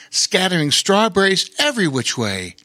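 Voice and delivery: ordinary delivery, nothing marked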